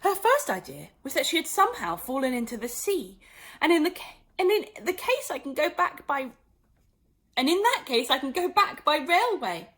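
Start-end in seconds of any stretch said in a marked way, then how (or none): 3.6-9.7 put-on light girlish voice, raised; a hopeful child thinking aloud; Alice's voice. Alice reasoning to herself in the pool.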